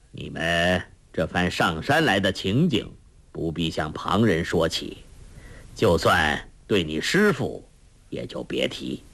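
measured, deep-voiced old master; kindly old master. A narrator's reading.

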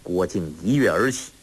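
ordinary delivery

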